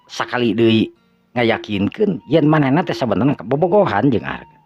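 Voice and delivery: ordinary delivery, nothing marked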